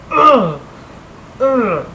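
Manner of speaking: loud high frustrated groans; frustrated ugh. Retold or imitated. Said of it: "they're like".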